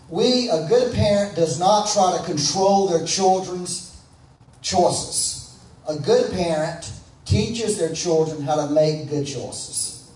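loud, slow, emphatic sermon delivery; full-force preaching. Acted. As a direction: drive each point home from the pulpit.

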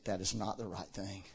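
a hushed inner warning. Retold or imitated. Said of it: "something in me was saying".

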